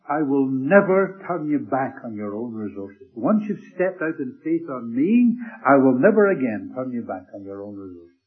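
fervent pulpit proclamation; preaching. Acted. Preaching the promise at force.

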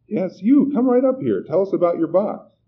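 high, loud coaxing call. A narrator's reading. Young host calling a man up from the audience, calling out. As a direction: summon someone from the back of the hall.

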